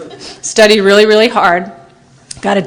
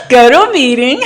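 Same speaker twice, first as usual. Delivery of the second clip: laughing mock sponsor; 10 semitones higher than usual. Mocking sponsor's stock answer.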